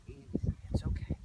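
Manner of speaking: breathy, whispered reassurance; calming. Acted. Owner to a freaked-out horse, whispered calming. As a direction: hush a frightened horse. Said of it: "a solution that's calming".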